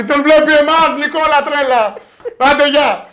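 high, loud mock rant; playful shouting. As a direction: holler at the rival across the way. Jokey shout-out to rival Nikola.

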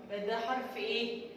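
usual speaking voice

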